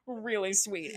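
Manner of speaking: ordinary talk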